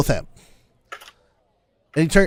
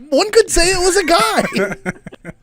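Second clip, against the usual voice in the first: coy laughing; 13 semitones higher, slower than usual. Acted. NPC coy about his guide.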